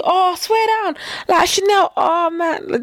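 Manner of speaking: high-pitched put-on enthusiasm; mock surprised recognition. Imitating someone returning the prank, mock surprised recognition. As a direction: act as if you suddenly know her. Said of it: "what if they did the same thing".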